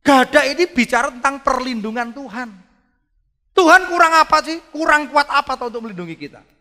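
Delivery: loud, high-pitched pulpit voice; preaching at full force. Acted. Preacher booming to his congregation in full force. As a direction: proclaim it to the congregation. Preaching climax on God's power.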